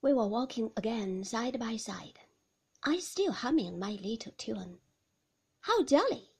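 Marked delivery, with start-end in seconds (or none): 5.6-6.3 high, loud, forced brightness; bright. A narrator's reading. Narrator feigning cheer to the reluctant man, brightly. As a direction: chirp it out falsely cheerful. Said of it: "I said brightly".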